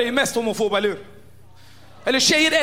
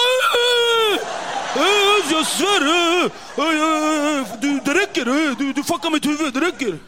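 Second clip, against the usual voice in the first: flustered rage; 2 semitones higher, slower than usual. Retold or imitated. Zoran sputtering at the kid's logic, mock fury. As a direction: sputter with wounded pride.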